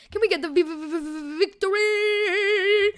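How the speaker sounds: stuttered v, then a long high loud victory; drawn-out, hammed-up victory cry; hype build-up. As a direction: stammer the v, then belt the word out.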